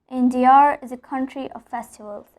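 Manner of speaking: "India" ending in an added R; intrusive R. Shown as a demonstration. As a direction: join "India is" with an R. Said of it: "just because there is a neutral vowel".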